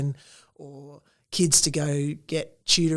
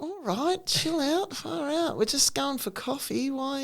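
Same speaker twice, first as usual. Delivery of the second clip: mock Aussie put-down; 10 semitones higher, 5 dB quieter than usual. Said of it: "and everyone's like".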